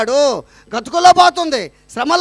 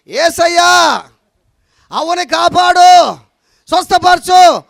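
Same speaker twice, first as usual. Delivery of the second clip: louder, slow prayer, every word stretched out; loud slow prayer for a deaf listener; 4 semitones higher, 6 dB louder, much slower than usual. Retold or imitated. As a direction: call the blessing out slowly so she can hear. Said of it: "ఇంక చెవుడని".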